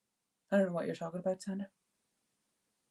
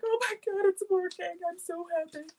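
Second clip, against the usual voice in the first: thrilled; 12 semitones higher than usual. Retold or imitated. Host recalling seeing a student's journal video, high gushing delight. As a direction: gasp it out like a proud teacher. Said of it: "I was like".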